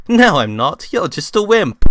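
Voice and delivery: high, loud playful jab; teasing kid voice. Acted. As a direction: tease him cheekily. Luke teasing Frank.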